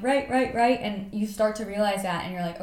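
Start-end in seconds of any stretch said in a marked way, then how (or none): 0.0-0.8 higher, self-mocking repeated "right"; mimicking verbal tic. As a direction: parody your own filler word, pitched up and amused. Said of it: "so many rights".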